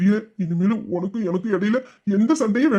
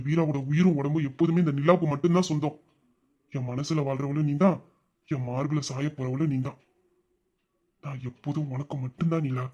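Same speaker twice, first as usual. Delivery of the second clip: soft romantic promise; 5 semitones lower, 4 dB quieter than usual. Acted.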